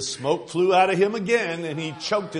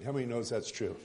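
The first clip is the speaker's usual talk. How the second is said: ordinary talk, nothing marked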